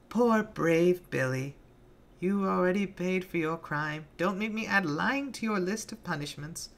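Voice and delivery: put-on a sadistic girl toying with her victim; Penny taunting Billy before the crowd; Penny's mock-sweet voice.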